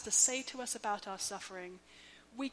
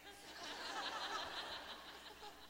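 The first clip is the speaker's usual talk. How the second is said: light laugh; 8 semitones higher, 10 dB quieter than usual. Real, not acted. Laughs after her joke.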